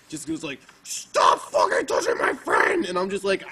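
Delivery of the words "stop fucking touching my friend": slow, loud demonic shout; voice of Satan's father. Retold or imitated. Imitating an angry girl at a show, demonic roar. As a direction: roar it slowly, like Satan himself. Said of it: "in the voice of, like, you know, his father".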